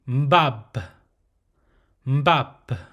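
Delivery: mbab drawn out slowly with a nasal start and a firm final b; slow it down, close hard on the b of mbab; closed mbab. Shown as a demonstration. Teacher modelling the closed syllable slowly. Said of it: "Now for the closed syllable".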